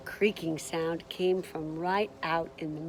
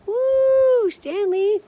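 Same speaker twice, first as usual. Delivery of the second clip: high, loud whoop; excited cheer; 9 dB louder, much slower than usual. Acted. Reader cheers Stanley after thieves go to jail, high whoop.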